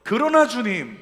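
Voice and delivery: very loud, high proclamation; shouted confession. Shown as a demonstration. Preacher modelling the confession, loud shout. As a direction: declare it with full force. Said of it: "한 번 더".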